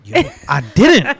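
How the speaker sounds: high, drawn-out outburst; loud exclamation. Retold or imitated. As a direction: blurt it out loud and high, mock-shocked. Acted past reaction, laughter around.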